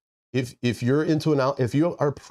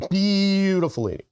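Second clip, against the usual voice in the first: high, slow, drawn-out praise; high sultry voice; 9 semitones higher than usual. Acted. Repeats the phrase higher and slower.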